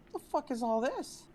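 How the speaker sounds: high-pitched, indignant retold outburst; shocked disbelief. Customer finds six charges on the account, high disbelief. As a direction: shoot way up in pitch, incredulous.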